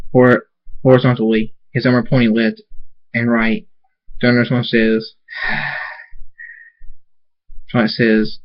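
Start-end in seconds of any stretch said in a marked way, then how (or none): 5.3-5.9 long, soft exhale; heavy breath out. A narrator's reading. Thunderstorm's wordless response in story.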